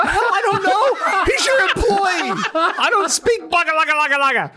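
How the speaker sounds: high-pitched comic protest; mock indignant, voice pushed way up; exasperated retort. Answering the plant owner, comically exasperated. Acts out heated baffled reply.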